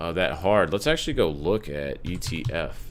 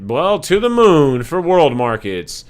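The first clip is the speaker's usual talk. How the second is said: mock cheer; 7 semitones higher, 8 dB louder than usual. Real, not acted. Cheering a new market high.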